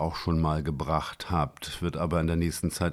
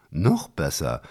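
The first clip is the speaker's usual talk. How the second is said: a louder, mildly giggly exclamation; fröhliches Kichern; 5 dB louder, slower than usual. A narrator's reading. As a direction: voice a touch of gleeful amusement. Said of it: "er kicherte weiter fröhlich vor sich hin". (fröhlich, leicht kichernd)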